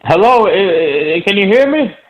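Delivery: distant speakerphone sound; talking over a phone on speaker. Real, not acted. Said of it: "It sounds like you're on speakerphone".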